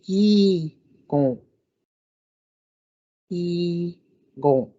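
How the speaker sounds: "yi gon" in two clear syllables; Ojibwe word for fish. Shown as a demonstration. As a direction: split "yi gon" for learners. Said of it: "The way we would say this".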